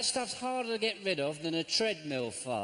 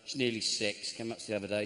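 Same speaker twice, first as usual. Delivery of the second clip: ordinary talk, nothing marked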